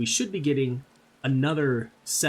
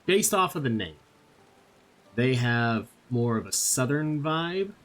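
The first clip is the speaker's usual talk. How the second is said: ordinary delivery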